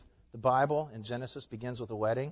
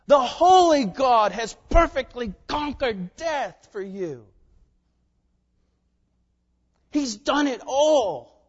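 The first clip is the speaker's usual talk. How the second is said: loud, raised-pitch, slow emphatic proclamation; fervent preaching; 9 semitones higher, 9 dB louder, slower than usual. Real, not acted. Pastor proclaiming grace and mercy to believers, fervent preaching. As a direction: drive each phrase home to the congregation.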